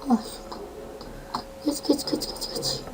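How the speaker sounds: breathless whisper, geç over and over; tense whispered urging; urgent whisper. Real, not acted. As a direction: hiss him forward under your breath. Urging the character on quietly.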